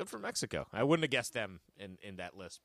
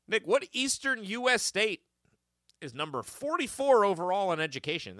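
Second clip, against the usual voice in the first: quiz host; 8 semitones higher, 4 dB louder than usual. Acted. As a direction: read it like a game show host. Quiz host reading question.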